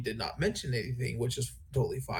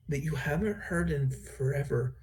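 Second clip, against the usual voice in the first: normal talking voice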